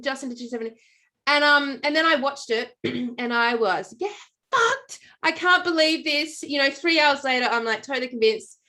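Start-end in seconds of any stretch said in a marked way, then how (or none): 4.0-6.4 1 shocked disbelief. Retold or imitated. Shock at flat Earth documentary.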